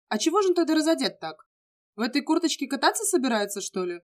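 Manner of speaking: выше и громче, озадаченно; с недоумением, не веря глазам; хмыкнув, с удивлением. A narrator's reading. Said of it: "хмыкнул проспект". (удивлённо и громко)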